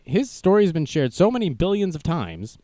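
ordinary talk, nothing marked

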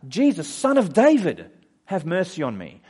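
loud acted cry for attention; yelling out. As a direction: call out for help over a crowd. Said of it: "And he yells out".

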